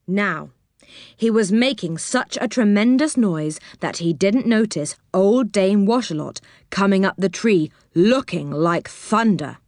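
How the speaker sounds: ordinary delivery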